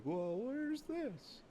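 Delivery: very high, soft, slow questioning voice; put-on high polite voice; polite tourist. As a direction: ask gently, like a meek polite visitor. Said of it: "a decent human being and not a jackass".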